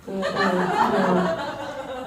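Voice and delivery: usual speaking voice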